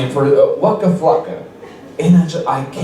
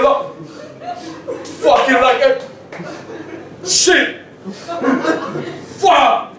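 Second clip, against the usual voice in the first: street battle rapper; 7 semitones higher than usual. Retold or imitated. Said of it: "and he was like".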